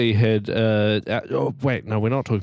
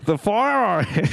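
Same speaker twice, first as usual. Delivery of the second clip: high, mock-amazed Aussie; intense Australian accent; 10 semitones higher than usual. Reacting to the hacker story, intense Australian accent. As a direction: squeal it in broad pretend Australian. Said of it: "very intense in your Australian accent".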